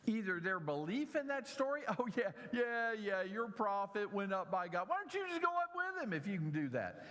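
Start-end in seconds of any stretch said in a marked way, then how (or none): 2.0-7.1 high, fast sarcastic taunt; mocking jeer. Boys jeering at Elisha about Elijah, sarcastic. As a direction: sneer at the prophet's story, quick and high. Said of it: "what they are mocking him about".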